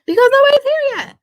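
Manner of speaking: high, fast, loud comeback; mock shout. Vlogger answering her own joke about absent viewers, in a mock shout. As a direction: fire back in a high playful yell.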